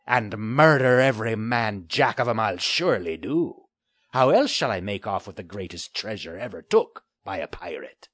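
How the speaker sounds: put-on a taunting old sea pirate; gruff pirate. Pirate Finley voiced.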